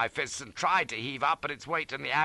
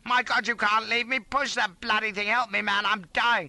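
high, loud frantic begging; desperate raised-voice wail; panicked cry; 11 semitones higher, 5 dB louder than usual. A narrator's reading. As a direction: beg not to be abandoned. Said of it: "cries I".

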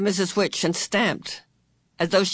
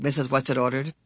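usual speaking voice

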